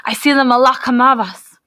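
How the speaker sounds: a loud, high, breathy cry of dread; he sees death and cries the warning; crying out in terror. Acted. The Bashan points at the officer, crying out in dread. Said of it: "cried out".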